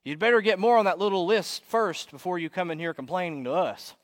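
booming heckler. Acted. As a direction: boom it out, topping the other's complaints. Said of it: "a voice booms from the back of the room".

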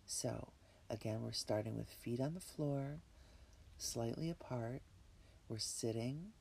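breathy whisper, calm and unhurried; guided meditation. Acted. Leads a relaxation meditation.

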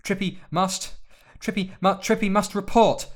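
high, loud, flustered elf voice; squeaky house elf. A narrator's reading. House elf caught spying, panicked high stammer.

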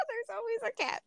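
giggly, high-pitched lament; laughing. Real, not acted. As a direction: let it ride up on a laugh. Laughs off her romantic luck.

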